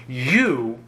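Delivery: normal talking voice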